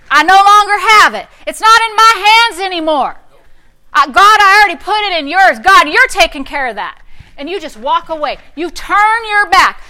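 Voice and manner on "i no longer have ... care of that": louder, higher, triumphant; loud declaration. Shown as a demonstration. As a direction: proclaim it with full force. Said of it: "start using your mouth".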